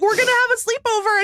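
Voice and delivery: high, fast excited call; excited grandkids. Retold or imitated. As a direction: gush like a thrilled grandkid. Acting excited adult grandkids.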